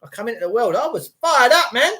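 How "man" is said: ordinary talk, nothing marked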